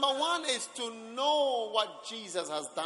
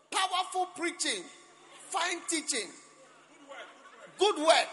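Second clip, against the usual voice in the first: higher, mild mock church shout of praise; retold shout; 10 semitones higher than usual. Preacher retelling a dozing man's outburst, mild mock shout. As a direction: call out the praise like a half-awake man, lightly. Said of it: "he shouted".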